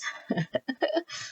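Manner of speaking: airy, high little laugh; breathy laugh. Real, not acted. Reader laughs at how long readings run. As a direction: laugh under her breath at spirit. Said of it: "Spirits is so funny".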